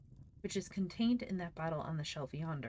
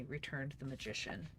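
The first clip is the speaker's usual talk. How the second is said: usual speaking voice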